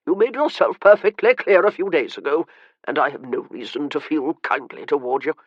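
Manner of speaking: lightly voiced old man, testy and cold; a touch of irritation in an old man's voice; irritable old man. A narrator's reading. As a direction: snap at him like a cranky invalid. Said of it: "he said irritably".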